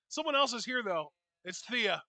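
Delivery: ordinary talk, nothing marked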